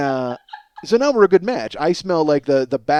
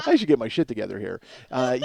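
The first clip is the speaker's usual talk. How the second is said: ordinary talk, nothing marked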